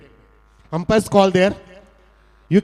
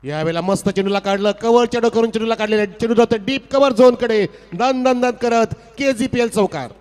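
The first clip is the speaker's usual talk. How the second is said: excited commentary; 5 semitones higher, 4 dB louder than usual. Real, not acted. Live commentary building to four.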